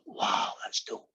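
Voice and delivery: slow, whispered wow; awed little kid. Acted. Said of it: "the little kid went".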